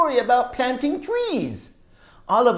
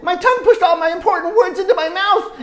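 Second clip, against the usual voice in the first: higher and louder, slowing to the burst; building excitement; 10 semitones higher, 13 dB louder than usual. A narrator's reading. Teacher reading, building to the volcano moment. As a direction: climb toward the eruption.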